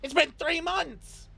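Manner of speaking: high, slow outraged cry; indignant mad wizard. Acted. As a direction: insist like an offended sorcerer. Sorcerer outraged.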